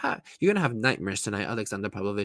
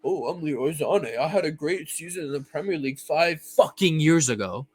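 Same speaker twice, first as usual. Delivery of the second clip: mock arrogant player; 2 semitones higher than usual. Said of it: "with his nonchalant attitude".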